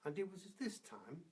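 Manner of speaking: usual speaking voice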